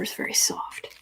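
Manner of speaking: whispering an aside. Real, not acted. Reader telling viewers about the cat's fur, whispering. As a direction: whisper so as not to disturb the cat. Aside while cat is close.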